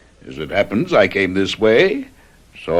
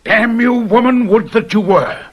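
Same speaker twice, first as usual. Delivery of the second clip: bitter curse; 8 semitones higher than usual. Acted. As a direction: spit the curse at the wife as if wishing her dead. Husband curses his living wife.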